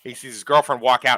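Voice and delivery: normal talking voice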